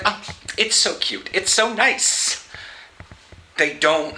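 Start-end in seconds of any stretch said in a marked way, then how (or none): 0.0-2.5 mock gushing. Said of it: "they're just like".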